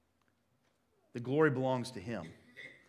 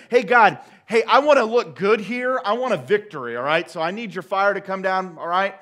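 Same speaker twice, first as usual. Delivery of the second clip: loud, higher, cocky demand; brash mock prayer; 4 semitones higher, 10 dB louder than usual. Imagined Elijah demands a victory from God, brash and loud. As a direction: order God around like a pushy customer. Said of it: "Elijah's not saying".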